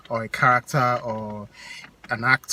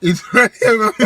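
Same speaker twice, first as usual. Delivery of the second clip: laughing; 10 dB louder than usual. Real, not acted. Mocking partner's phrase.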